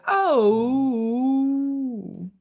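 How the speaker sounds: high, pouty little dog "Oh."; a hint of a sulky dog; dog's voice. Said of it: "she'd be like".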